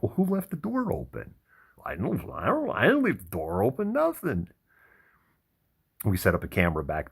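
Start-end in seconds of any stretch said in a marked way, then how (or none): 0.0-1.3 mock accusing question. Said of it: "then it's like". 1.8-4.5 mock defensive denial. Mock denial of leaving door.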